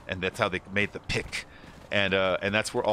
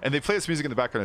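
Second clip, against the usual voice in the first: normal talking voice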